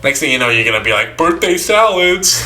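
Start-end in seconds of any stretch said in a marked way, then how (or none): 1.2-2.4 high, drawn-out mock cheer; hammed-up party excitement; mock announcement. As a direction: announce it slowly in a raised voice. Mock announcement of a silly treat.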